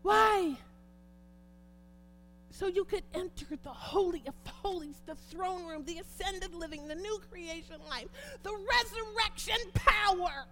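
preaching at full force. Acted. Preacher crying out thanks to Jesus before the church, fervent and loud.

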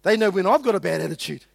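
ordinary talk

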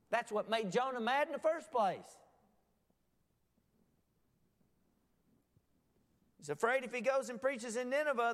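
high, loud, impassioned sermon delivery; full-force preaching. Acted. Preacher explaining Jonah's anger to congregation, full-force preaching. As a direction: drive it home at the top of your preaching voice.

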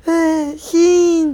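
whiny whimper. Retold or imitated. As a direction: whine like a sick kid. Whining while feverish.

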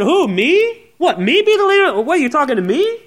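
high, amazed "who, me?"; humble disbelief. Retold or imitated. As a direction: play the humble young Saul, startled. Humble Saul voiced in sermon.

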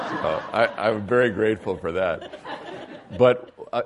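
talking through laughter; laughing. Real, not acted. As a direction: chuckle through the words. Laughs at escaping the meeting.

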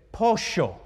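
"pollo" with ll said as sh; "pollo", ll as sh, pitch up; Argentine sh. Shown as a demonstration. Preacher shows how an Argentine says "pollo" with sh. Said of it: "He can't pronounce it the same way".

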